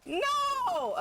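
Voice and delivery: high, wailed refusal; escalating wail of protest; anguished protest. Real, not acted. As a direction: cry out in rising high-pitched dismay. Protesting opponent's move.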